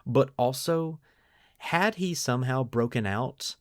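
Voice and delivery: ordinary talk, nothing marked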